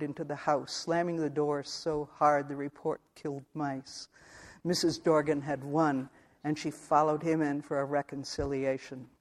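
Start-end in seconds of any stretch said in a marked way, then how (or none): none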